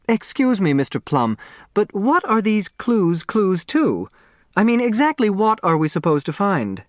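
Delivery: a haughty woman demanding answers; Grace Wexler voice. A narrator's reading.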